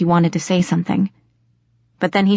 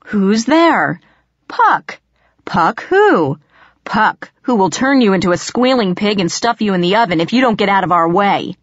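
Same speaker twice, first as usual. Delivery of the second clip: animated, pitched-up joke routine; mock-cheerful threat; 3 semitones higher than usual. A narrator's reading. Rob performing the whole joke at the door, mock-threatening. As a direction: act out both parts, then menace playfully.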